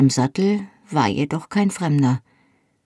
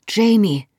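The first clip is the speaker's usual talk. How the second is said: a light panted name; breathless gasp; 6 semitones higher, 3 dB louder than usual. Acted. Wounded Ian gasps to his sister, breathless. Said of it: "keuchte er".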